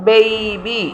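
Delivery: "ബേബി" as bey-bee; careful drawn-out model "ബേബി"; correct pronunciation. Shown as a demonstration. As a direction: glide through the first vowel of "ബേബി". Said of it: "എന്നുള്ള usage ഇല്ല".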